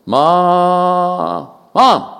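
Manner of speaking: put-on a scared kid calling for mom; child calling mom. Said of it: "I'd start calling my mom".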